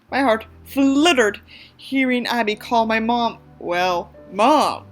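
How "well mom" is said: ordinary delivery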